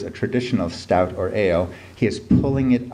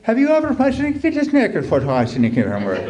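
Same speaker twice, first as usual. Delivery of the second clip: much higher, louder put-on Irish lilt; exaggerated Irish accent; 4 dB louder than usual. Reader slipping into a comic Irish accent. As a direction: ham up a thick Irish accent, baffled. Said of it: "a good Irish accent".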